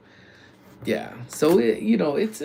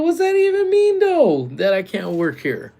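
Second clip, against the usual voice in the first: loud high-pitched mock protest, easing down at the end; exasperated retold outburst; 9 semitones higher, 8 dB louder than usual. Worker challenges the woman's claim, retold exasperated outburst. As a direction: burst out high and loud, acting baffled. Retells his exasperated comeback.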